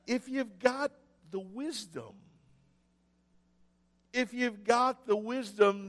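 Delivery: ordinary talk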